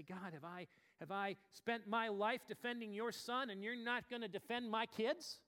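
loud, high, demanding cry at God; angry plea to God. Retold or imitated. Pastor argues with God, loud indignant plea. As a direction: confront God with rising anger.

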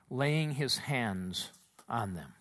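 usual speaking voice

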